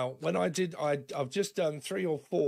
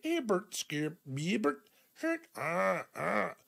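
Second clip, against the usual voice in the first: higher, slow put-on alien babble; alien speak; 4 semitones higher, slower than usual. Grandkids and you chatting in mock alien babble. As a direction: mimic a playful alien tongue. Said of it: "is it like alien how you speak".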